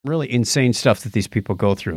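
ordinary delivery

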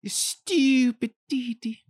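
high, drawn-out cartoon voice; cartoon scolding. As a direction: squeal it slowly as an exasperated cartoon character. Mimics Dexter scolding his sister.

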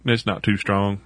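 lightly put-on Southern accent. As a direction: talk like a Southern truck guy. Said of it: "I did the Southern accent".

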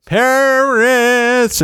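mock cheer. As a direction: sing out the name with sarcastic glee. Exclaiming after bird poo.

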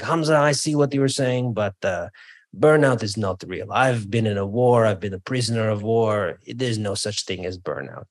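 imitated Bulgarian accent, trailing down at the end; thick Bulgarian accent. War survivor disputing burnout to the speaker, imitated thick Bulgarian accent. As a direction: play a war veteran with a put-on Bulgarian accent. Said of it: "he says in a very thick Bulgarian accent".

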